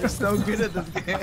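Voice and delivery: laughing. Real, not acted. Teasing friend while laughing.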